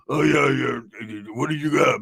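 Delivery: slow, drawn-out sleepy drone; lightly voiced half-asleep man; Roach's groggy voice. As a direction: mumble dreamily, dragging the words. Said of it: "Roach would lose his groggy voice".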